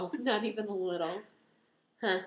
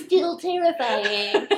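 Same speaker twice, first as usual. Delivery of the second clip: mock drama; 3 semitones higher, 6 dB louder, much slower than usual. Acted yearly reminder post.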